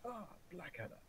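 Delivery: high-pitched imitation of a general; hammed-up general, high then easing down; retold general. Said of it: "the general comes in".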